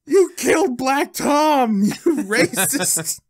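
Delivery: slow, high, loud mock outrage; drawn-out hammed-up yell with a laugh breaking in; Cable's furious accusation. Quoting Cable's angry line.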